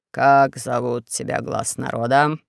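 low, slow, hushed demagogue; Four-Eyes. Acted. Dictator accusing the prisoners, low slow speech. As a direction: drone the accusations like a sly dictator. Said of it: "спросил Четырёхглазый".

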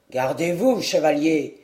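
a small knight calling before the charge; dwarf's challenge. Acted.

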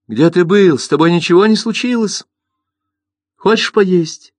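high, worried mother voice; anxious mother. Acted. Anxious mother questions son.

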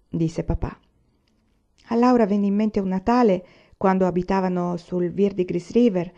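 ordinary delivery, nothing marked

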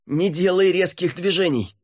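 high, alarmed command; shouted warning. A narrator's reading. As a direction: yell a warning across the drop.

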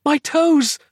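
high, loud scream of horror; horrified scream. A narrator's reading. Patient finds her toes gone, screaming in horror. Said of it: "screamed Roz".

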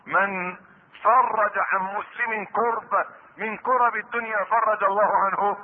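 ordinary talk

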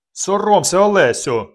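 high, loud yell; full-voiced scolding shout; bellowed reproach. A narrator's reading. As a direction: bellow to shame someone into stopping. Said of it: "загорлав він".

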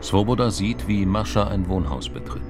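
ordinary delivery

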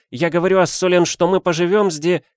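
put-on young countess. A narrator's reading. Countess repeats herself.

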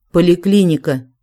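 ordinary delivery

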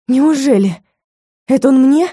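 can't believe her luck; amazed. Acted. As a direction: heroine stunned the man heard her. Heroine amazed she was heard.

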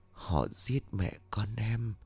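soft, slow murmur of a dead woman close by; ghostly murmur. A narrator's reading. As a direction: breathe it slowly into his ear like a ghost. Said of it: "anh nghe giọng nói của Hân bên tai". (thì thầm chậm rãi như hồn ma)

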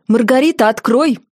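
mother-in-law demanding entry through the door; indignant call. A narrator's reading. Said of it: "негодующий голос свекрови".

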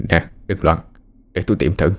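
subdued, slightly weakened husband; mildly weak, low-energy reassurance. A narrator's reading. Tired husband calms panicking wife, slightly weak voice. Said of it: "giọng hơi yếu".

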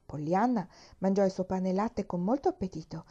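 ordinary delivery, nothing marked

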